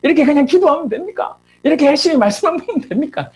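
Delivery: high, indignant questioning; angry challenge. Retold or imitated. Frustrated student challenging the leader over endless prayer meetings, angry challenge. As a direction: imitate a frustrated friend's heated complaint. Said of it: "저한테 막 화를 내는 거예요".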